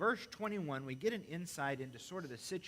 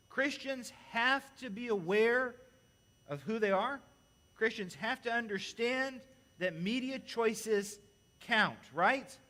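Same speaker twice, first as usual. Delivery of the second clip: louder, higher, slower exhortation; forceful preaching; 6 semitones higher, 6 dB louder, slower than usual. Acted. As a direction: press it on the congregation.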